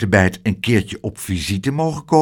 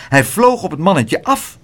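plain reading voice

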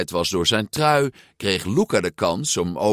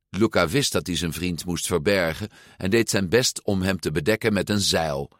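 usual speaking voice

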